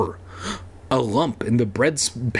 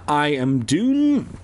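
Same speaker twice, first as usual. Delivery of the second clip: usual speaking voice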